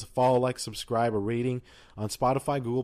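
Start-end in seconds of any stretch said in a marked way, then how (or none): none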